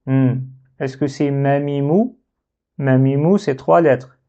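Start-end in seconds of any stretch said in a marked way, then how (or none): none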